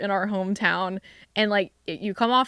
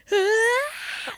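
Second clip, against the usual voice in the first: high, sharp gasp of alarm; startled gasp; 13 semitones higher than usual. Retold or imitated. Driver reacting as a car runs into hers, startled gasp. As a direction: gasp as if about to be hit. Acting out being crashed into.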